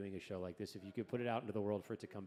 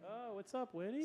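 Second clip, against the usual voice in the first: high-pitched greeting; 14 semitones higher than usual. Real, not acted. Greeting the artist's sister.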